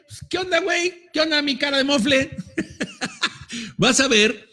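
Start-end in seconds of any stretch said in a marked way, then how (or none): 0.1-2.4 high, laughing teasing hello; laughing mock greeting. Shown as a demonstration. Speaker shows the audience how to greet a neighbour, laughing. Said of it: "y ríete". 2.4-3.7 a very high, soft burst of laughter. Shown as a demonstration. Speaker demonstrates laughing at the person beside you, with a high giggle. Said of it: "y ríete".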